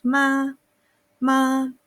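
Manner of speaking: "Ma" sung out long on one level note; first tone. Shown as a demonstration. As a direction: hold "ma" steady, like singing. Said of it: "mantenemos el tono".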